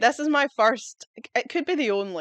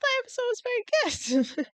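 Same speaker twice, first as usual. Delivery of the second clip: very high, tiny squeaky voice; mock squeal. Repeating a reluctant episode verdict, comic squeal.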